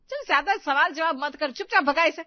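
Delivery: loud, high, impatient command; scolding order. Acted. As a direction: shut down the argument loudly. सास बहू को चुप कराती है. (डाँटते हुए ज़ोर से हुक्म)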